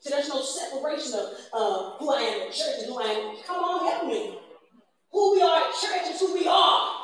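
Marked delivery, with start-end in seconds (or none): 3.4-6.9 rising to a full shout on the last line; preaching shout. Real, not acted. Preacher shouting his point to the congregation, fervent. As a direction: call the church to answer, then shout it home.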